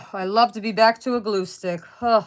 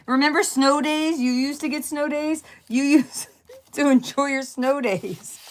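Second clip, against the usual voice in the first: mocking; 6 semitones higher than usual. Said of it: "I'm like".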